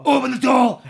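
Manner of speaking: high, very loud bellow; shouted order. Retold or imitated. Man outside demanding the driver let him in, bellowing. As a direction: bellow it as an imitated threat.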